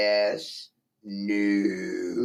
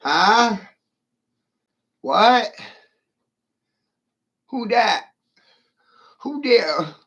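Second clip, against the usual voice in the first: high, loud startled calls; mock startled; 9 semitones higher, 5 dB louder, slower than usual. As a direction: jump up as if spooked.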